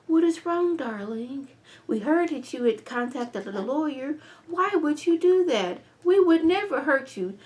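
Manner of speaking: high syrupy mock-sweet voice; sugary fake concern. Victoria sweet-talking Gail, high and syrupy. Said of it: "That, that's [name]".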